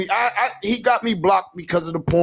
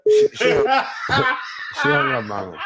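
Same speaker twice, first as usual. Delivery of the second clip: laughing hard; 4 semitones higher than usual. Real, not acted. Laughing as caller hangs up.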